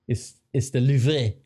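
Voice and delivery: put-on a light German accent, slowed, on the Louvre; lightly voiced German teammate explaining it slowly. Scene, Gundogan telling Stones how to say it, light German accent. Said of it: "or however he says it".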